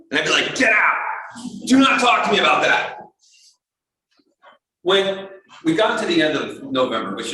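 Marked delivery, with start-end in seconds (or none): 0.5-3.0 high, raised-voice hostile outburst; mock-angry order to back off. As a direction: act out snapping at a team member. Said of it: "I started getting hostile".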